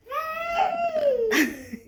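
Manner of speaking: high squealing giggle; high laugh. Real, not acted. Mother laughs at child.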